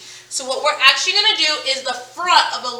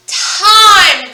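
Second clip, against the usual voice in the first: "time" called out loud and higher; word read out; 6 semitones higher, 8 dB louder than usual. Shown as a demonstration. Teacher reading the first sorting word to class, loud. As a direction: announce "time" clearly. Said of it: "the first word we have is".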